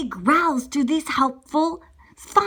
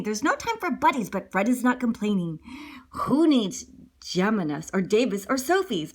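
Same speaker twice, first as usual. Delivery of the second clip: plain reading voice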